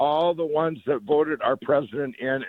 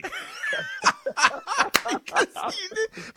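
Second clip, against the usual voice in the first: big burst of laughter. Real, not acted. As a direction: howl with laughter at the punchline. Laughs at his own punchline.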